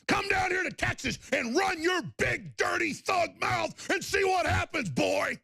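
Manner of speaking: host daring a thug to come to Texas, angry shout; angry challenge. Real, not acted. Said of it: "threatening challenge".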